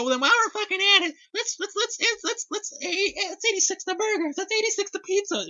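high, squeaky frantic impression of Amy; Amy's impression. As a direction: stammer it out in a high put-on voice. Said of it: "Amy proceeds to go".

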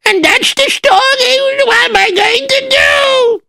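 high, slow, sobbing plea; crying plea. Acted. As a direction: beg the Rebbe through tears. Pleading to the Rebbe.

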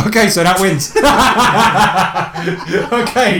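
loud laughing announcement. Real, not acted.

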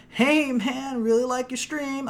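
imitating the kid. Streamer imitating the boy who joined his party, lightly voiced. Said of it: "like a southern lilt to his voice".

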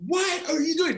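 high sharp demand; angry coach. Retold or imitated. Coach confronting an unready sub, high angry demand. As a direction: snap at the lazy sub.

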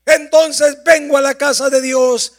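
loud, rising preacher's voice; impassioned pulpit delivery; fervent preaching. Acted. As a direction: drive the sermon home at full force. Preaching at full force.

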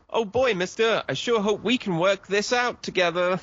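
put-on high, eager gee-whiz boy; bright-eyed small-town kid pleading; wholesome 1950s kid. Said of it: "suddenly go".

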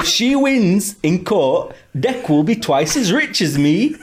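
hammy Geordie, slipping into others; Geordie accent. Acted. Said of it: "should I do it in a Geordie accent?".

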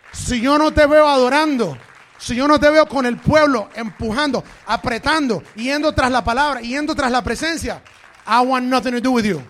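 loud, rising pulpit cry; fiery preaching. Acted. Pastor thundering at preachers who won't worship, loud preaching. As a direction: preach it full force to the congregation.

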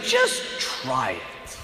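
slow, soft, low invitation; gentle and calm. Retold or imitated. Alexander gently inviting conquered people, slow soft voice. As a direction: coax them gently, slowly. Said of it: "very gently, very calmly".

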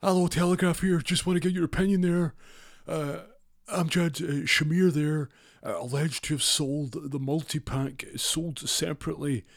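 high, loud newspaper reporter voice; pushy reporter. Retold or imitated. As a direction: shove a mic in and fire questions.

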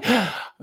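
sharp high gasp for air; retelling near death, a sharp gasp. Retold or imitated. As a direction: gulp a sudden breath back. Nearly dying, breath returns.

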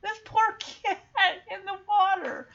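high, slow, tearful lament; choked up with pity. Real, not acted. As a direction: draw it out as the tears come. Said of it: "I'm crying".

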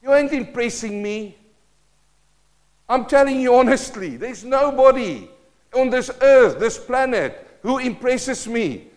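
a preacher pressing hard on his people; fiery preaching. Acted. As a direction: preach it out to the whole room. Preacher at full force.

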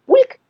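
a sharp, high, loud бульк; splash sound. Acted. Narrator imitates ducks diving, high splashy бульк. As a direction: pop the word like a duck diving.

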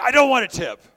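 sudden loud, high angry shout; retold shout at the passenger; furious driver. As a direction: bark it back like a furious cabbie.

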